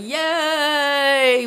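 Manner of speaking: high reenacted cheer, not full volume; drawn-out mock yell; yelling, screaming. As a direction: imitate a long excited scream. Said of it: "yelling, screaming".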